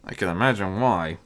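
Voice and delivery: plain reading voice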